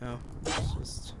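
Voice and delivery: soft breathy aside; whispering. Real, not acted. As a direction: whisper it under your breath. Aside while playing.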